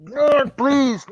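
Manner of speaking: high, loud mock-pleading; exasperated plea. Real, not acted. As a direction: beg them in playful despair. Begging friend to watch films.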